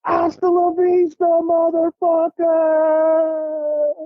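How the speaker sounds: high, slow, drawn-out cry; gleeful yell. Retold or imitated. Jumping from the plane, high gleeful drawn-out cry. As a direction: howl it as you let go. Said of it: "I went".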